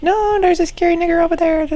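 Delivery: high squeaky voice breaking into laughs; high giggling doll voice; squeaky toy voice with laughter. Acted. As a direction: play the toy voice, laughing at yourself. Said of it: "this is how I would be doing it".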